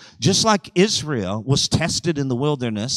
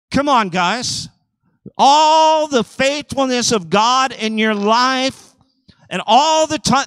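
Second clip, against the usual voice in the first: full-force preaching; 8 semitones higher, 6 dB louder than usual. Acted.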